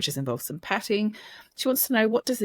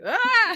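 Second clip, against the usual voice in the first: much higher, louder mock toddler wail; toddler's test cry; 5 dB louder than usual. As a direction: cry out like a toddler testing the game. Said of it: "What happens if I go".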